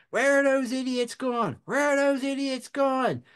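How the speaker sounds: exasperated chief's high-pitched yell; imitated yelling. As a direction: yell it like an incredulous boss. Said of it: "he starts yelling again".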